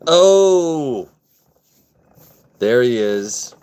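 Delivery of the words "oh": high, loud drawn-out "oh"; excited whoop of recognition; delighted greeting. Real, not acted. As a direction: welcome an arriving friend with a big oh. Greeting a friend who arrives.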